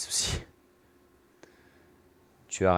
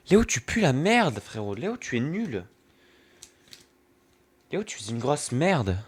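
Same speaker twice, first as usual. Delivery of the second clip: teasing taunt; 6 semitones higher than usual. Pretend. Playful insults aimed at friend Léo.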